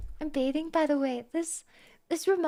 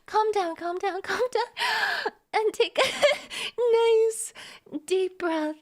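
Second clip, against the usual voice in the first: mock calming; 7 semitones higher than usual. Calms herself inside the fan text.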